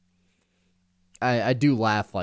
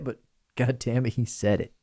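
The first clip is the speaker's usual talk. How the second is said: normal talking voice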